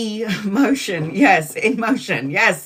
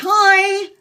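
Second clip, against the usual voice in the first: high, bright-voiced make-up greeting; overly sweet reconciliation call; sheepish sweet hello; 7 semitones higher than usual. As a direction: chirp it back sweetly, laughing a little. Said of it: "And then I'll be like".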